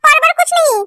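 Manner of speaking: high, tearful plea breaking into a cry; a pleading girl near tears. Acted. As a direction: beg through tears. Girl pleads, crying heard.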